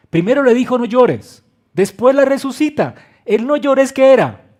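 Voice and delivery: preacher booming Jesus' promise to grieving listeners; full-force preaching. Acted. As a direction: declare "No llores" as a promise. Preacher retells Jairo's story.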